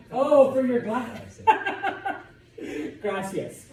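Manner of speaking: loud, high laughing aside; laughing thanks. Real, not acted. Teacher laughing as student brings a glass. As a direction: break into a laugh over the glass.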